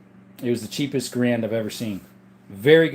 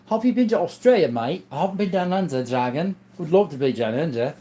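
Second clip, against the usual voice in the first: higher-pitched, hammed-up Aussie accent; terrible Australian accent; 4 semitones higher than usual. Streamer playfully answering a viewer about Australia, mock Australian accent. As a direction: put on a bad Australian accent. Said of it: "terrible Australian accent".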